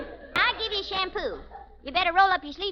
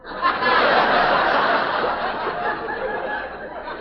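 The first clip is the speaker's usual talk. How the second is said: laughter; 4 dB louder than usual. Real, not acted. Audience laughing at joke.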